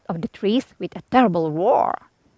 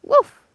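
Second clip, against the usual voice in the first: a sharp high woof like a small dog; dog bark. Acted. Narrator barks for Toto.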